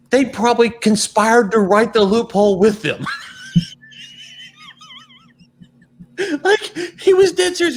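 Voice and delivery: shouting through real laughter; laughing. Real, not acted. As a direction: burst out laughing mid-rant. Mocking the lawmakers, cracks up.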